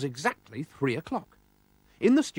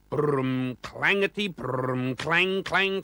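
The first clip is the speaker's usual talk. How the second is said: engine noise; 2 semitones higher, much slower than usual. A narrator's reading.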